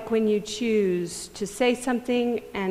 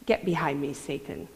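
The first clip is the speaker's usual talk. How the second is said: low, dropping rebuke fading to near silence; firm rebuke; 6 semitones lower, 5 dB quieter than usual. Acted. Jesus rebuking Peter, a low quiet firm rebuke. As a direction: shut him down quietly and firmly. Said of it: "Jesus comes right back at him".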